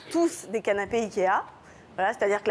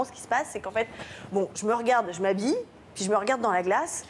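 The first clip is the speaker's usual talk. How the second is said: ordinary talk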